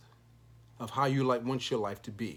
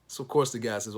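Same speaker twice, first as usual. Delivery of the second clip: ordinary talk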